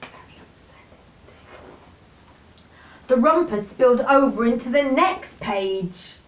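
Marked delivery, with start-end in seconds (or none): 0.0-1.7 hushed whispered aside. Real, not acted. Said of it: "aside to listener".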